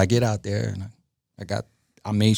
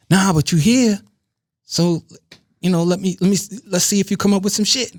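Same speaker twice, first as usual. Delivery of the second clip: client's coaxing line, pitched up; lightly voiced client; 8 semitones higher than usual. Client asking producer to stay and try, lightly coaxing in a higher voice. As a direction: imitate a client talking you into staying, higher.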